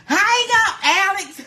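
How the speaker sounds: mock urgent yelling; demanding yell. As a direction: shout at her to drop the other call. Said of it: "call friend, demand they hang up".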